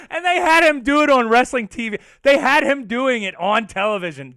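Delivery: loud, high, laughing disbelief; delighted, laughing outburst; cracking up. Real, not acted. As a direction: shout it through real laughter. Cracking up at wrestling story.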